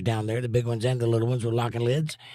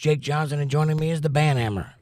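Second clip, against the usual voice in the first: ordinary delivery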